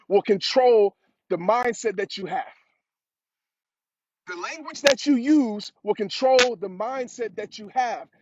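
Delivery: slow, every word weighted; repeating the key lesson to viewers, slow and deliberate; slow emphatic repeat. Real, not acted. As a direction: drive the point home slowly. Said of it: "repeats the key line".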